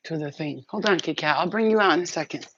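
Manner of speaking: ordinary talk, nothing marked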